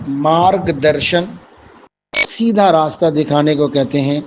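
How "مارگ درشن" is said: مارگ درشن said slowly, each syllable clear; مارگ درشن drawn out as a teaching model; slow careful pronunciation. Shown as a demonstration. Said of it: "Hindi میں کیا کہا جاتا ہے؟".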